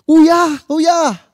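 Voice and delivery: high, loud call across the street; shouting. Retold or imitated. Stranger calls out to the father from the jeep, loud high shout. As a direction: yell to stop the passing jeep. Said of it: "Biglang may sumigaw".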